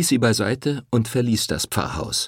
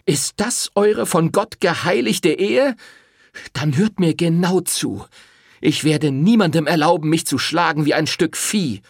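furious defiance; 9 semitones higher, 5 dB louder than usual. Acted. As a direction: draw the line with fury. Rosetta defies the priest. (trotzig wütend)

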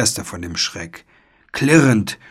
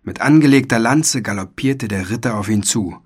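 plain reading voice

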